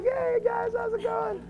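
very high, cheery mock voice; hammed-up high voice, waving from the ledge; goofy high greeting. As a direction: chirp it like a carefree kid.

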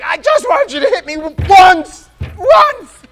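very high, loud, hammed-up cry; mock pleading shout. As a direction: yell it like a desperate madman begging for a hit. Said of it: "It's like".

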